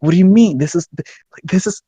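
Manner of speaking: acted-out heated objection. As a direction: sputter in disbelief, losing your cool. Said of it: "I got heated".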